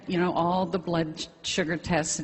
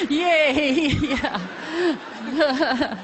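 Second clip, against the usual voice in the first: laughing cheer; 7 semitones higher than usual. Real, not acted. Joking about being a chocolate-holic, laughing cheer. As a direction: cheer along with the crowd.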